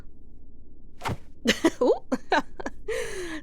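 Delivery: light airy chuckle; a warm breathy laugh; fond laugh. Acted. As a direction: laugh off the teasing. Amused banter.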